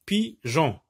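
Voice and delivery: Pigeon as PI, pause, GEON; two detached beats for Pigeon; split into syllables. Shown as a demonstration. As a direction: isolate PI at the start of Pigeon. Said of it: "la même syllabe".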